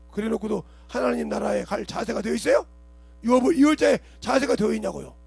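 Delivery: 높고 크게 회중을 다그치며; 교인들을 꾸짖는 뜨거운 설교; fiery preaching. Acted. (꾸짖는 설교 톤)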